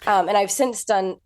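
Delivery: softened British accent, put on; American doing a polished British accent; English accent. Shown as a demonstration. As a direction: speak in a convincing English accent. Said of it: "you really do sound English".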